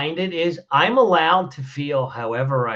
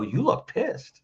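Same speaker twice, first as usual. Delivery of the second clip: "look" lifted higher and drawn out, mocking; a taunting "You look pissed." with a rising "look"; mock blunt jab; 6 semitones higher, 3 dB quieter, slower than usual. Advisor mocking a tactless opener to a stranger, taunting rise. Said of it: "somebody and go".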